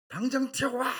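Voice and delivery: imitated army bellow, very high and loud; explosive mock military command; shouted order. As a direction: yell a furious summons like a sergeant major. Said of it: "소리를 저렇게 질러대시는데".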